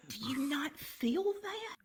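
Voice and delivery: high, soft, slow acted wonder; hushed awe. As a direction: imitate a guide eerily sensing a presence. Guide senses the ghost.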